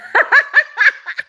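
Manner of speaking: high, loud giggling fit; real helpless laugh; burst of laughter. Real, not acted. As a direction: crack up in high-pitched giggles. Amused by a viewer's flirty comment.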